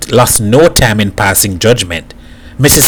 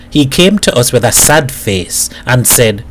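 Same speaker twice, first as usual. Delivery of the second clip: ordinary delivery, nothing marked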